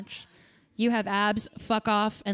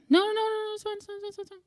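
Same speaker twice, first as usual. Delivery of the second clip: high fading reassurance; 9 semitones higher, much faster than usual. Retold or imitated. Owner hastily reassuring her Roomba, high and fading. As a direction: backpedal sweetly, trailing away.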